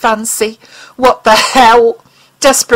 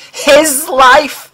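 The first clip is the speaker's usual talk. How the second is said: higher, pained disbelief; despairing question; 6 semitones higher, 4 dB louder than usual. Acted. Mulder despairs at the alien's words, higher and pained. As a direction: ask it as if the ground just vanished. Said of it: "Mulder asked despairingly".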